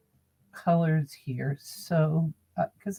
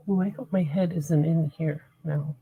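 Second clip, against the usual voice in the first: normal talking voice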